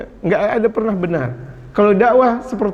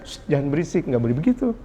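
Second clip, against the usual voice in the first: soft, lower-pitched gentle admonition; gentle shushing; 4 semitones lower, 6 dB quieter, faster than usual. Acted. Preacher models wise adult correcting noisy children, gentle hushing. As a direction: hush them kindly, keeping the voice down. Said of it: "Tapi orang yang bijak".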